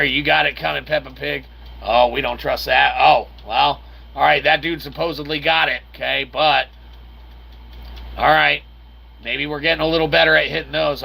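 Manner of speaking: ordinary delivery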